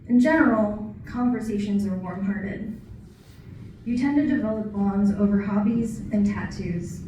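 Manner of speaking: deliberate recital, paused between phrases; poetry reading. Acted. Poet sharing what working at a veterans home taught, poetry reading. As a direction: deliver each line as verse, measured and projected. Reads own poem about veterans.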